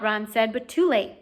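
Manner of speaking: ordinary delivery, nothing marked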